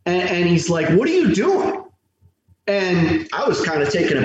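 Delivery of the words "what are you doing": sharp, much higher challenge to a stranger; angry demand. Retold or imitated. Local man confronting a stranger at his family grave, angry and high. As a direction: snap it out like a suspicious local. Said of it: "he's kinda angry".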